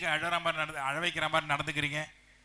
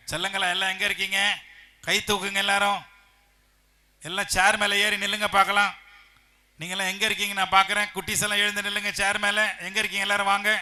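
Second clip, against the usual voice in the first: high, loud rallying call; 5 semitones higher, 8 dB louder than usual. Real, not acted. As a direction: call the little ones up, projecting hard. Calling out to children in audience.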